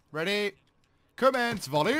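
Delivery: high, loud order to fire; shouted command. Real, not acted. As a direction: bark the order to the squad. Squad leader ordering a grenade volley.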